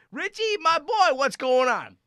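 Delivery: high, loud buddy hail; jokey hearty greeting. Retold or imitated. Joking around greeting Richie, loud playful hail. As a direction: call out playfully to a friend. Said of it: "I'm like".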